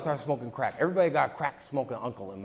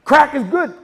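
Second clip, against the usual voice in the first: high, loud sing-song slogan; 11 semitones higher, 12 dB louder, slower than usual. Acted. Comic shouts a slogan to the crowd, high and loud. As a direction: sell it like an ad jingle.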